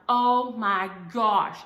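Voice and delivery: stretched-out frustrated gasp of words; exasperated oh my gosh. Retold or imitated. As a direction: let it out slow and loud in frustration. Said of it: "And I'm like".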